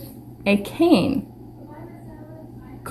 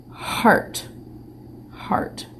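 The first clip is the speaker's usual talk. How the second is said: whispered sounding out; 9 dB quieter than usual. Shown as a demonstration. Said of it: "That's not a C sound".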